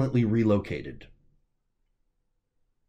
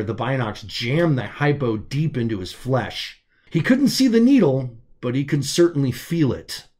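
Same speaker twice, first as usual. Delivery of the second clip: ordinary delivery, nothing marked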